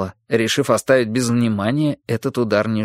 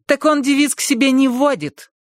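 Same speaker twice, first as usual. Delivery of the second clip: high, scolding woman through the door; a stern housekeeper demanding entry; bossy housekeeper voice; 13 semitones higher than usual. Acted. Essie scolding. (голос сердитой домработницы)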